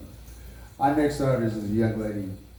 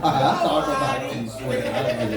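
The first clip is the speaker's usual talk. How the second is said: laughing louder; 5 dB louder than usual. Real, not acted.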